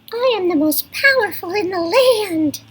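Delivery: high grandiose boast; boastful character. Acted. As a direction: puff up and brag in a squeaky grand voice. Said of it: "say it in a voice to match who's talking".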